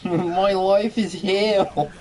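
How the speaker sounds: very high, slow anguished voice; stuck character voice. Retold or imitated. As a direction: wail it stuck in the voice. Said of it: "stop talking like that".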